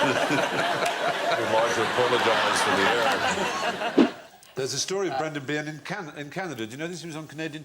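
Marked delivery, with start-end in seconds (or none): 0.1-4.1 laughing apology. Acted. Reading the paper's apology, laughing loud. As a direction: barely get through it for laughing.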